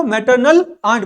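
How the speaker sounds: "Maternal आंट" said slowly, each syllable clear; slow pronunciation drill. Shown as a demonstration. Teacher drilling students to repeat after him, slow and clear. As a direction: spell out "maternal आंट" slowly for the class to copy. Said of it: "बोलो मेरे साथ".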